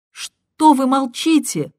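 high, loud demanding shout. Acted. Caller shouts at the silent husband. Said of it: "кричала она".

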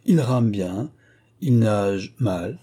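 "Il rame bien, il nage mal" itemised with drawn-out endings; counting off. A narrator's reading. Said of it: "en comptant sur ses doigts". (« Il rame bien, il nage mal » énuméré en traînant)

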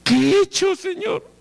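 high, startled prophet voice; Ezekiel's bewildered question. Acted. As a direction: ask the Lord in disbelief. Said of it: "y dice".

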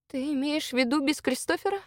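soft, high, hesitant question; dismayed question. A narrator's reading. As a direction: ask it quietly in disbelief. Woman dreads leaving her comrade behind.

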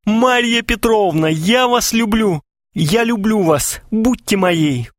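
high, drawn-out mock moan; groaning lover. A narrator's reading. Suitor kneels before Maria Petrovna, moaning. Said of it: "простонал".

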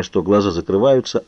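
ordinary delivery, nothing marked

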